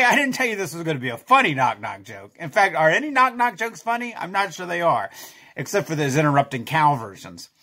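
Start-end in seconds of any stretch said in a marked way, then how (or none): none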